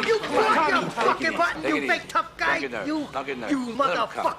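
high, loud taunting screams; screaming taunts at the man as friends hold him back; raging yell. Acted. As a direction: yell and jeer while they drag you off. Raging while being held back.

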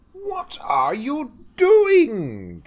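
high, loud, drawn-out shout; crying out. Acted. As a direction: cry out in alarm, stretching DOING. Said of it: "he cried".

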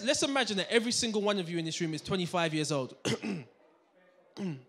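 normal talking voice

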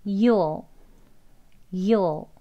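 YOU'LL with the oo shortened; reduced "You'll", not drawn out; shorter contraction. Shown as a demonstration. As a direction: model "You'll" as a brief "yuh-ol". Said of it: "짧게".

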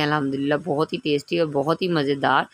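ordinary talk

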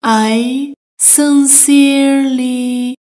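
"I sincerely" stretched out slowly; slow it down so learners hear each sound; slow, careful. Shown as a demonstration. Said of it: "teacher repeats slowly". (آهسته و شمرده)

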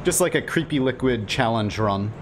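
ordinary talk

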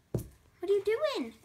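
high, slow question to a pet; high-pitched cooing; pet talk. Real, not acted. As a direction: draw out a puzzled question to your ferret. Questioning the ferret.

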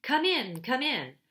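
loud, high and eager welcome; eager call. A narrator's reading. Wolf calls Edmund inside, loud and eager. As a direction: call him in with a mock welcome. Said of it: "came bounding back and said".

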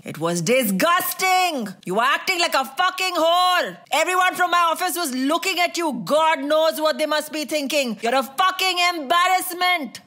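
high, strained furious tirade; angry outburst. Acted. Husband shaming his wife after the party, high and furious.

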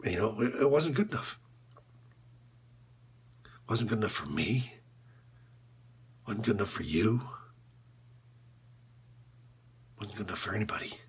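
low, soft, fading nearly to a whisper; hushed, halting confession; subdued regret. Acted. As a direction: let the voice sink away as you admit it. Coach confesses shame after outburst.

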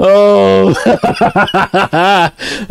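renewed burst of laughing; helpless laughter. Real, not acted. Streamer still tickled by the clip, helpless laughter. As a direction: keep cracking up. Clip played one more time.

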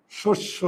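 "শস্য" softly as shosh-sho; pronunciation demo. Shown as a demonstration. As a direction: round both syllables of "শস্য" to ও. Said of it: "এই ওকারের সঙ্গে দিয়ে দিলাম". ("শস্য" আস্তে, ও-কার দিয়ে)

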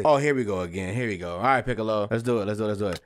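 usual speaking voice

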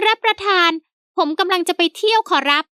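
high, eager fawning servant. A narrator's reading. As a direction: pitch it up as the polite old servant. Servant Haew voiced by narrator.

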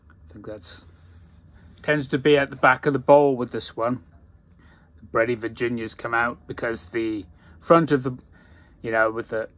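talking around pipe stem; pipe in mouth. Real, not acted. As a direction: lips clenched on stem. Seen 2: Pipe stem sits at the mouth corner, held, while talking.

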